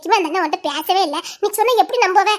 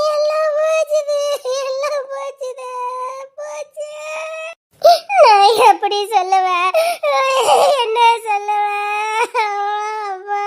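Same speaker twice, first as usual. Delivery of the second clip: slow, drawn-out crying words; helpless melodramatic weeping; sobbing wail; 3 semitones higher, slower than usual. Acted. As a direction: stretch every word into a sob. Sobbing that all is lost.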